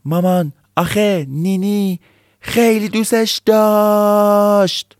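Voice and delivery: put-on high, slow, tearful child's cry. Nini cries out to mom about the deer, tearful. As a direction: wail it like a heartbroken little kid. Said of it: "اونا هم فریاد زدن".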